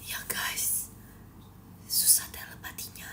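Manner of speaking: very soft, voiceless whisper. Real, not acted. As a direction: whisper it, barely any sound. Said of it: "Kita bisik-bisik ya". (berbisik pelan)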